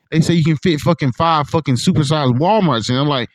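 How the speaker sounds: usual speaking voice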